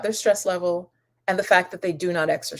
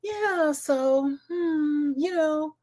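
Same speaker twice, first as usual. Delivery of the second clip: calmed-down aunt; 8 semitones higher, much slower than usual. Retold or imitated. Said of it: "she was like".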